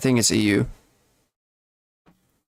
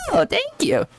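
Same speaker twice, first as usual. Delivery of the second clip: very high-pitched squeal of gratitude; acting out a touched reaction; retold delighted thanks; slower than usual. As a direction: squeak it, imitating delighted surprise. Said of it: "And I was like".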